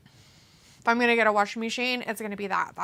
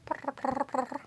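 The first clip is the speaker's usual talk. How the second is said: imitating purr; 4 semitones higher, 5 dB quieter, slower than usual. Coaxing cat to purr.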